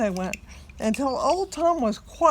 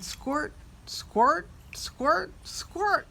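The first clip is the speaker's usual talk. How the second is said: rising squirts; 3 semitones higher, much slower than usual. A narrator's reading. Rising milking sound words.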